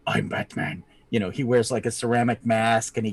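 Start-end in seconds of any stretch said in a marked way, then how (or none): 0.1-0.8 low, gravelly Batman growl. Retold or imitated. Mouse doctor boasting as a Batman-style vigilante. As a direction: growl it like Batman, much lower. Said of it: "he's like".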